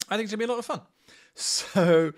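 ordinary talk

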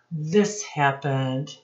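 normal talking voice

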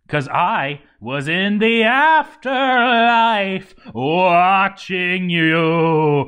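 high, loud, very slow creepy gloat. Acted. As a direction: savour it like a ghoul watching. Creepy boast to dwarves.

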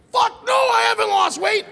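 high furious shout of denial; outraged yell. Retold or imitated. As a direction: scream it out, offended. Outraged denial of weight compliment.

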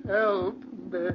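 put-on an old man begging into the dark; terrified old man. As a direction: plead in rising panic. Old man crying for help.

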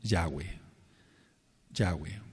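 low, falling YAH-weh, stress on YAH; Yahweh said as two syllables, YAH-weh; careful pronunciation. Shown as a demonstration. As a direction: model the name Yahweh slowly, YAH first. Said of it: "el nombre se pronuncia".

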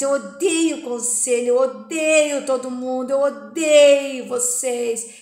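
very loud hateful scream; screaming hatred. A narrator's reading. Joyce screams at her family in the hospital. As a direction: scream it at all of them.

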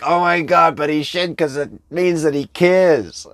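high, loud mock protest; indignant protest. Listener protesting to the coach about forgotten birthdays, high mock indignation.

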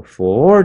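"for" pushed out loud and long; heavy stressed syllable. Shown as a demonstration. Teacher marking the heaviest syllable, "for" drawn out strongly. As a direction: lean hard on "for". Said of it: "for的地方最重".

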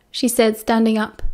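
ordinary delivery

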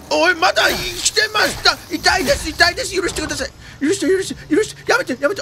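high frantic begging under attack; panicked pleading. Real, not acted. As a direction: beg for mercy in a panic.

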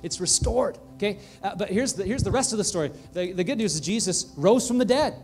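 loud, high, exultant preaching; triumphant pulpit climax; full-force preaching. Acted. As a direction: proclaim the good news with fire.

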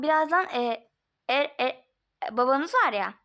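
ordinary talk

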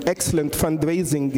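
normal talking voice

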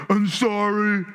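put-on grudging kid's sorry; insincere apology. Kid told by parent to apologize, insincere. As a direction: say sorry without meaning it. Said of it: "you don't actually mean it".

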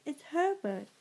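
a restrained storyteller's scream; mild narrated scream. A narrator's reading. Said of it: "she screamed".

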